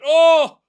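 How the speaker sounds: sudden loud high shout; real startled cry; shocked yell. Real, not acted. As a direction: burst out at the screen. Goal chance, sudden reaction.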